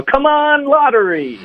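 high-pitched, drawn-out mock cheer; acted, hammed-up high wishing call; excited plea. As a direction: squeal it like begging luck to deliver. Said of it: "就说".

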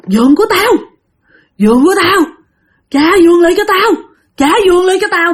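high, loud hoarse ghost demanding its bed. Acted. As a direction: rasp it out like a corpse wanting its bed back. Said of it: "cái giọng của người này khàn đặc".

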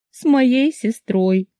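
high and very slow tragic wail; milk the tragedy, drawn out. A narrator's reading. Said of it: "добавила я трагично".

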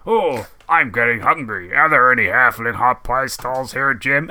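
slow, sing-song sports commentator character; drawn-out, hammy commentator voice; game commentator impression. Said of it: "it was when he's like".

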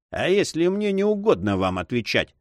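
disdainful cavalier's question; haughty. A narrator's reading. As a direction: refuse loftily, voice lifted. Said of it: "надменно заметил кавалер".

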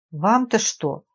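ordinary delivery, nothing marked

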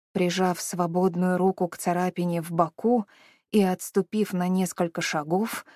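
ordinary delivery, nothing marked

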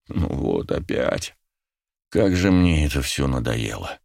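low, slow, weary lament; resigned and fed up, sinking low; voice wavering. A narrator's reading. Narrator caught by the familiar crimson glow, weary wavering voice. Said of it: "мой голос задребезжал".

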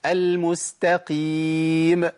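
final mim of المستقيم bounced with a vowel release; slow wrong tajweed demo; qalqalah on mim. Shown as a demonstration. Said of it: "في ناس بتقلقلها".

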